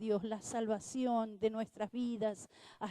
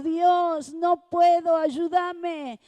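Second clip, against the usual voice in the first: loud, high plea "no puedo"; desperate cry; 6 semitones higher, 12 dB louder, much slower than usual. Retold or imitated. Humbled believer crying out to God, loud and high. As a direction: shout it out as a broken believer.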